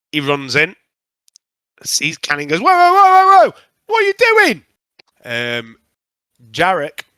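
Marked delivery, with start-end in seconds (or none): 2.6-4.6 much higher and louder, startled yelling; alarmed shout. Acted. Tichilos runs in and sees Canny, shouting in alarm. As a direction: throw up a panicked high-pitched "whoa".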